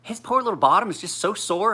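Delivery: high, mock-sweet church lady gossip; grandma fretting to the church ladies, high and sugary; grandmother voice. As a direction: imitate a fussy grandmother cooing. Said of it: "She's like".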